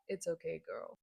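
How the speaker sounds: normal talking voice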